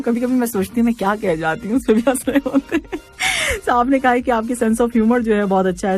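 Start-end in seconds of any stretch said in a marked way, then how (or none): 3.0-3.6 high happy giggle; short falling giggle; real laugh. Real, not acted. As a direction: laugh at yourself. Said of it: "मुझे बड़ी खुशी होती है जब आप लोग हंसते हैं".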